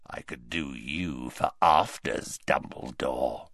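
very low, soft rasp of menace. A narrator's reading. Greyback threatens to eat Dumbledore for afters, rasping. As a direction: rasp it deep and hushed, like a predator. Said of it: "rasped Greyback".

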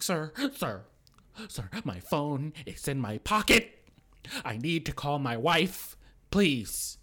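high, loud, slow appeal; hammed-up plea to an officer; mock pleading. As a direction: spell out each request like a desperate captive. Arrested man pleads for phone.

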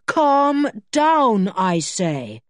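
commanding, emphatic repeat; firm command. A narrator's reading. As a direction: insist firmly that a friend calm down. Julian repeats his order firmly.

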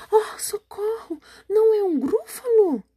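high, wailing cry for help; terrified cry. Acted. Frightened creature crying for help on spotting the gruffalo, high wailing panic.

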